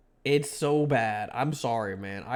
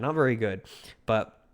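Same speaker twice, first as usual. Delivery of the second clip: ordinary talk, nothing marked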